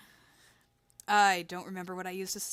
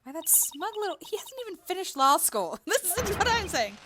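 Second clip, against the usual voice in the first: higher-pitched, fuming indignation; indignant; 9 semitones higher than usual. Acted. Young lawyer fuming about the student who snubbed her, indignant. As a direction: sputter at the smug rival.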